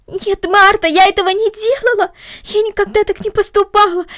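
put-on high, loud upset girl; upset outcry. A narrator's reading. Girl pouring out her denial to Martha, upset outcry. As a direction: burst out in distress.

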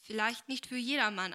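earnest, firmly voiced reply. A narrator's reading. Agnes answering her cousin on marriage, earnest. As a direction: answer seriously, with some weight. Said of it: "antwortete Agnes ernst".

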